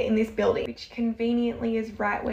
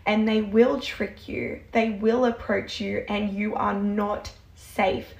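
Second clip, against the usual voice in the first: normal talking voice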